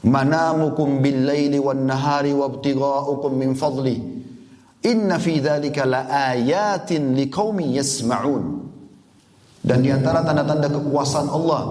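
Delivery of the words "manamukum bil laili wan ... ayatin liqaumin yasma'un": slow melodic chant of Arabic verse; tilawah-style chanting; Quranic recitation. Acted. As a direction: draw out each phrase like tilawah.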